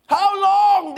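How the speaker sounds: crying out. Retold or imitated. Preacher voicing David's cry to God, loud and pleading. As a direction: call out to God in anguish. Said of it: "David cried".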